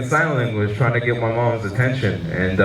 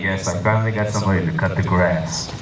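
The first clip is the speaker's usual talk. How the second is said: dad's voice; 7 semitones lower than usual. Retold or imitated. Dad voiced in a standup story.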